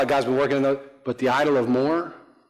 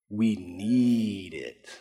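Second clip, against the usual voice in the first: low, softer, long-drawn NEED; mock neediness; 5 semitones lower, 5 dB quieter, much slower than usual. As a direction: stretch "need" out slowly and low. Voicing self-justifying cliché.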